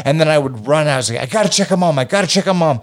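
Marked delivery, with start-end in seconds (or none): 1.2-2.8 frantic worry. Retold or imitated. Tripping kid worried for mom.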